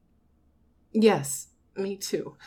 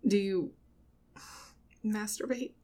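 normal talking voice

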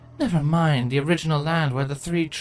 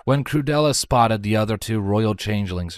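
ordinary delivery, nothing marked

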